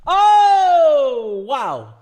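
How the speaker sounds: high loud startled oh; shocked cry. Real, not acted. Bad river card reaction.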